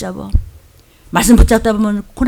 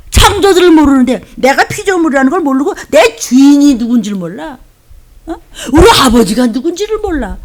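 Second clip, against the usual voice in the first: fervent rebuke; 3 semitones higher, 5 dB louder than usual. Real, not acted. Preacher rebuking the ignorant.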